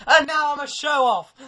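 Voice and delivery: high, loud mock boast; boastful proclamation. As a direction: belt it out like a proud show-off. Mock boast after meeting teacher.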